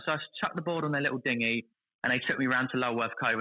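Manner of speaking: ordinary talk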